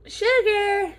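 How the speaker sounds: high loud call. Real, not acted. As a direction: call the ferret's name sharply. Calling a ferret by name.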